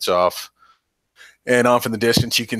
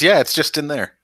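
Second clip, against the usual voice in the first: captain's voice, higher; 8 semitones higher, 4 dB louder than usual. Acted. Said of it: "He says".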